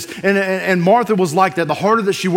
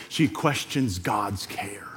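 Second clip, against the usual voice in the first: whispered; 10 semitones lower, 11 dB quieter, slower than usual. Real, not acted. Pastor drops to a hush.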